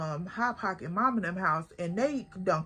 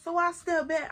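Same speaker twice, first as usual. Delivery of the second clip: normal talking voice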